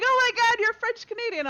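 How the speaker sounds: loud, high mock-excited outburst; amazed gushing. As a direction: imitate friends losing it with excitement. Retold amazed reaction of gaming friends.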